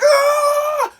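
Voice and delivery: high loud charging yell; battle cry. Retold or imitated. Charging at the man.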